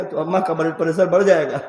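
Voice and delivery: normal talking voice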